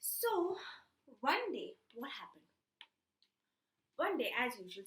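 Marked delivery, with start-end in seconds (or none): none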